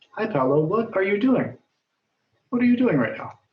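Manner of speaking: a teacher playing an eager student asking a friend; curious question. Shown as a demonstration. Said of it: "Li Wu wants to know".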